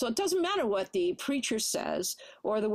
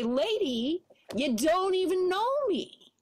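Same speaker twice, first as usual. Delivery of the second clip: sharp, louder, higher retort; uncivil tone; 8 semitones higher, 3 dB louder than usual. Retold or imitated. To the woman who says she loves me, uncivil retort. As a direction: snap back at her rudely. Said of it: "in the most uncivil tone of voice".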